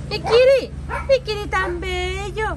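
high, slow cooing pet voice. Real, not acted. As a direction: dote on the cat in a high sing-song. Cooing to her cat.

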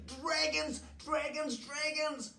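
very high, panicked townsfolk cry; high-pitched acted alarm; storybook scream. A narrator's reading. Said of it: "they screamed".

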